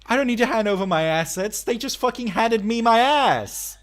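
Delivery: high, loud indignant quip; protest, half laughing; indignant joke. Real, not acted.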